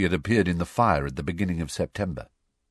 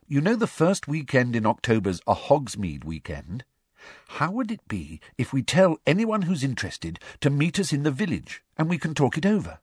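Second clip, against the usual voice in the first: put-on higher, quickening girl's voice; Hermione's voice; 7 semitones higher than usual. A narrator's reading. Hermione proposing the Hogsmeade meeting, higher and quick. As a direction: lay out the plan eagerly.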